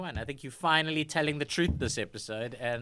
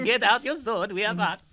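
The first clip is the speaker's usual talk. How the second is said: put-on high, loud Swedish accent, laughing; rally them in a comic Swedish accent; 7 semitones higher, 9 dB louder than usual. Swedish soldier rallying a comrade to fight, hammed-up Swedish accent. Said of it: "that's my Swedish accent".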